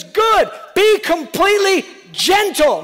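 loud, high, slow apostle's shout; shouted command. Acted. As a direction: bellow the command as Paul. Paul voiced commanding firmly.

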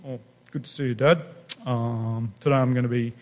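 polite casual greeting. Retold or imitated. Preacher mocking polite prayer, low and offhand. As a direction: say it like a polite visit, not a cry. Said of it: "It's not like".

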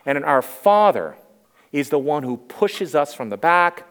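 normal talking voice

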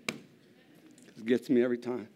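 slow, halting, fighting back tears; choked up. Real, not acted. Speaker at the pulpit, overcome while telling of God's call, choked up. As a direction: voice catching with real emotion.

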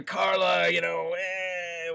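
loud, high, annoyed whine; exaggerated nagging whine; whiny sitcom grump. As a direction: whine it out, drawn-out and fed up. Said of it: "whiny thing from Cheers".

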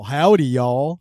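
loud cowboy howdy; mock Texan howdy. Outsiders greeting a Texan in a loud put-on drawl. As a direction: belt out a hammed-up Texan howdy. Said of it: "the first thing I hear is".

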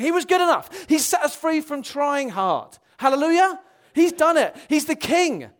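high, loud preaching at full force; exultant, ringing pulpit cry; triumphant proclamation. Real, not acted. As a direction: build to a shouted declaration of victory.

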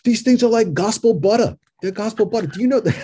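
high, loud Staten Island excitement; Staten Island accent. Retold or imitated. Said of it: "he had that heavy accent".

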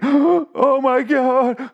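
drawn-out, high, loud gasped dismay; mock horror. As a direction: wail it in hammed-up shock. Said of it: "That's the look on his face".

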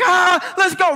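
football pregame yell; pregame hype. Retold or imitated. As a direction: pump up the team. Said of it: "they would get so amped up".

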